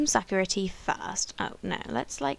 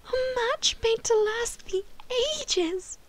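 much higher, near tears with joy; overjoyed gasp; 14 semitones higher than usual. Real, not acted. Player hearing the guru's prophecy, overjoyed and tearful. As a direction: gasp and gush through happy tears. Guru predicts lasting match.

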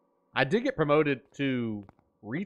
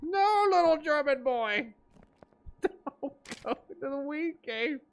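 imitating the chat voice; 13 semitones higher than usual. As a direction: squeak it like the text-to-speech voice. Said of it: "And then the German boy in the".

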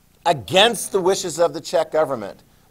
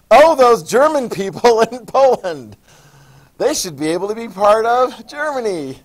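mock innocent Hitler; 7 semitones higher, 8 dB louder than usual. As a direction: play Hitler sweetly claiming the Germans. Hitler voiced in retelling.

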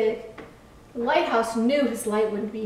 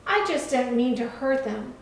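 plain reading voice

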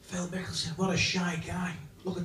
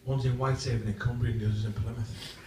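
ordinary talk

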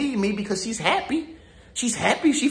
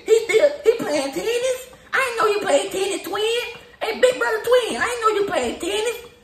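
high, laughing mock surprise of a jealous friend; mock jealous hater; 11 semitones higher than usual. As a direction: squeal it with fake amazement, much higher.

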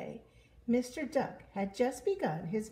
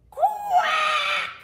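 very high, loud squawk; exploding angry-duck cry; furious duck quack; 11 dB louder than usual. Acted. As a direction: scream a long quack. Duck totally snaps.

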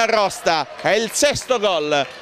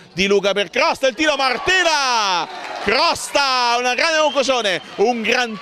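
higher, stretched goal call; excited goal call; 5 semitones higher, slower than usual. Real, not acted. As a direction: ride the shot into the net. Calling a goal live.

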